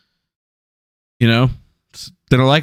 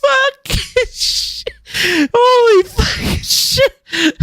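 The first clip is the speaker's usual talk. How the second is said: high, slow stunned swearing; stunned disbelief; much slower than usual. Real, not acted. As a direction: gasp out the curse in shock. Stunned by the quote.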